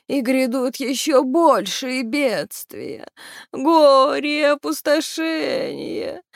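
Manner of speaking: wailing lament. A narrator's reading. Banshee keener foretelling disasters to come, slow wail. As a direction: draw out each word like a mourner's howl. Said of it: "выла плакальщица".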